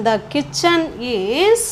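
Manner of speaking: very slow, long rising final word; slow dictation. Shown as a demonstration. Teacher building the present tense passive, slow dictation. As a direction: draw it out and leave it open.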